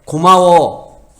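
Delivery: slow, loud, stretched-out "고마워." with every syllable drawn long; drawn-out thanks. Shown as a demonstration. Preacher shows parents how to thank their children, slow and drawn out. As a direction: lean into "고마워." and stretch it, firm and heartfelt. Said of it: "아이들한테".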